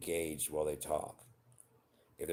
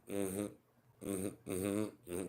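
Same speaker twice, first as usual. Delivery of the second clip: dismissive mm-hmms; 3 semitones higher, 5 dB quieter, much slower than usual. Retold or imitated. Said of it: "That would be nicer than going".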